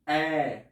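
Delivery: "E" held as a long open German Ä, eh; vowel demo. Shown as a demonstration. Teacher leading students to repeat the umlaut Ä. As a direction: model "E" for the class to repeat. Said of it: "repetid conmigo".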